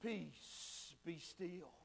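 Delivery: very soft, low word of calm; hushed command. Acted. Jesus to the storm from the bow, hushed command. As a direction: quietly still the storm.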